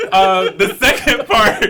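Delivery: high laughter breaking the words; can't stop laughing. Real, not acted. Still laughing at her own joke, laughing helplessly.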